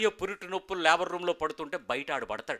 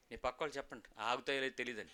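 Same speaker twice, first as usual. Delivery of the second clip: ordinary talk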